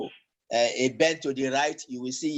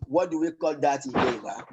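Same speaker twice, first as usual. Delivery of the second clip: ordinary talk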